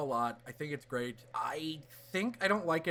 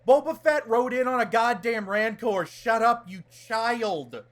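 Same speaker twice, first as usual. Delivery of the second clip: mock scolding; 7 semitones higher, 7 dB louder than usual. Mock scolding complaining fans.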